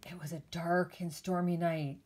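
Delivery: whispered scary-story opening; spooky whisper. Acted. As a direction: hush it like a ghost story.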